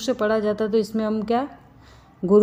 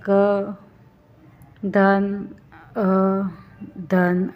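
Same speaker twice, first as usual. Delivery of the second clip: drawn-out vowels; much slower than usual. Shown as a demonstration. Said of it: "अ और आ दो मात्रा है ना".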